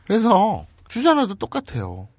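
ordinary talk, nothing marked

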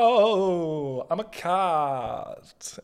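high, long drawn-out meowing cat. Acted. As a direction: meow and slowly slide down in pitch. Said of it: "every cat's voice is just like".

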